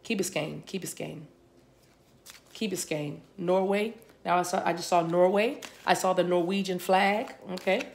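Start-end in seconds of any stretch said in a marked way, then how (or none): 0.0-3.2 softer murmured place name; soft entranced repeats. Real, not acted. Psychic reader murmuring a place name she receives. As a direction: murmur it as if listening inward.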